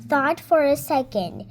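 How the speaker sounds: usual speaking voice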